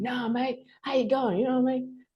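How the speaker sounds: high-pitched insistent Australian imitation; Australian accent. Local repeating a greeting to confused newcomer, Australian accent. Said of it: "I could really do the Australian thing".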